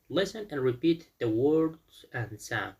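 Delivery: slow, deliberate textbook reading; slow instruction. Shown as a demonstration. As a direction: read it out slowly to the class. Teacher reads instruction aloud.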